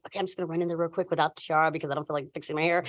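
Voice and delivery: telling herself she'll pop in without the tiara; muttered to self. Retold or imitated. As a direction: rush it under your breath. Inner thought, rushed.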